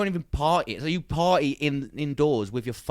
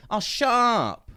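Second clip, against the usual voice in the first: dismissive mock retort; 8 semitones higher, much slower than usual. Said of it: "He's like".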